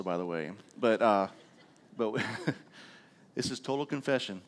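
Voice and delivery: ordinary delivery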